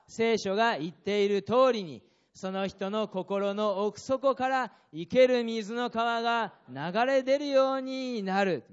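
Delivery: slow, loud, proclaiming scripture; read aloud loudly. Shown as a demonstration. Pastor leads the congregation reading the verse aloud, slow and loud. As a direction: call the verse out to the whole church. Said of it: "大声で言いましょう".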